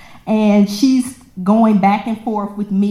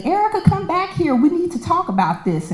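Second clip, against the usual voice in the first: high, quick call after someone; lightly voiced coworker calling out; 9 semitones higher, faster than usual. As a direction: mimic the coworker calling her back, pitched up. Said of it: "I heard her say".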